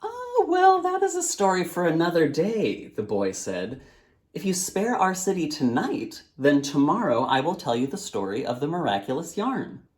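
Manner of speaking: put-on very high, playful child; boy's voice. Boy bargains with the djinn, high and playful. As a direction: tease and bargain again.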